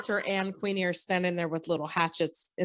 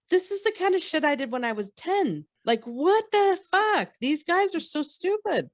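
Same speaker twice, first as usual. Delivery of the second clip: high, incredulous outburst; real exasperation, pitched way up; exasperated; 10 semitones higher than usual. Real, not acted. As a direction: rant in disbelief at their stupidity. Continues exasperated rant at characters.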